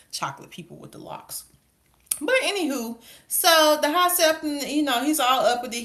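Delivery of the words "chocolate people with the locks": whispered aside. Real, not acted. Aside about the Velaryons.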